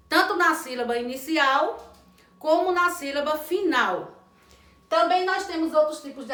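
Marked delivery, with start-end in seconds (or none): none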